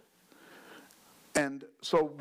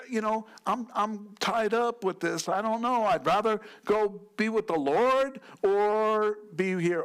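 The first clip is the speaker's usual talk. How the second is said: higher, lightly acted Paul musing; voicing Paul; 6 semitones higher than usual. Preacher lightly voices Paul choosing between staying and going. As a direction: muse aloud as a content Paul. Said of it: "He said".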